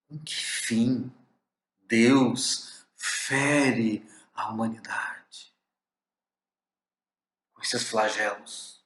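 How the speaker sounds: slow, hushed, aching whisper; pained whisper. Shown as a demonstration. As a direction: breathe the question out slowly as a sorrowful whisper. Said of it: "a entonação, a carga de emoção".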